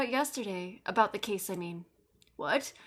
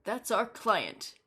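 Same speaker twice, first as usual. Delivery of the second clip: normal talking voice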